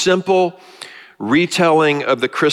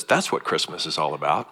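soft, lower, tender telling; Linus, gently; 6 semitones lower, 7 dB quieter than usual. Retold or imitated. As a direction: hush it down to a friend, lower and softer. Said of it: "Linus walks over to Charlie Brown and says".

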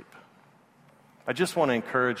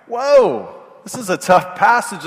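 much higher, louder whoa; startled whoa; 9 semitones higher, 7 dB louder, slower than usual. Retold or imitated. Preacher reacts to the tough Bible passage, startled.

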